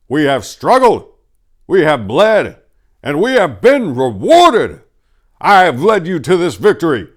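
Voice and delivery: loud, high, ringing address to a crowd; rally the lords like a conquering king; rousing victory speech. Acted. Falcon's victory speech to guests.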